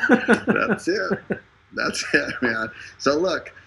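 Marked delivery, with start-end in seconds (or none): 0.1-1.2 high, laughing voice; laughing agreement. Real, not acted. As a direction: laugh through the words. Laughs along at co-host's joke.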